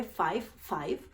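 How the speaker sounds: mock the clerk dryly; dry retelling of five five; deadpan mockery. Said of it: "Solo todo lo que dijo fue".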